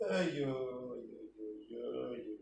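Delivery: low, fading sighs of ayyo; weary "ayyo" lament. Real, not acted. Teacher despairing that nobody listens, weary fading lament.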